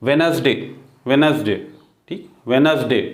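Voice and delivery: वेडनेसडे as "wenz-day", first d dropped; silent D. Shown as a demonstration. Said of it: "डी यहाँ silent है". (वेडनेसडे में पहला डी silent)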